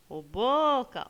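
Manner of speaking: "boca" called with a long, loud BO; calling the word. Shown as a demonstration. Teacher repeats the call for pupils, drawn-out BO in "boca". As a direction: stretch the first syllable of "boca" as if calling it. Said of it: "Chamem a palavrinha boca".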